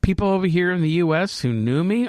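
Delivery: ordinary delivery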